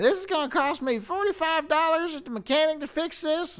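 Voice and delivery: high, loud screeching rant; berating crackhead yell. Retold or imitated. Crackhead woman berating a driver over the repair bill, screeched yelling. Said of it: "she is just yelling".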